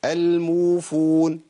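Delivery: الموفون with uu held two counts; correct waw madd. Shown as a demonstration. Teacher repeating the right waw length. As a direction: stretch الموفون evenly. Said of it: "مثال الياء".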